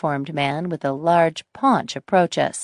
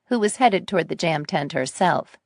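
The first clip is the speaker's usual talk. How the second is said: plain reading voice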